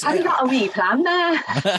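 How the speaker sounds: imitating a Scottish woman's accent; mock Scottish accent. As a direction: put on a playful Scottish accent. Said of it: "I'm not going to do the, the accent again".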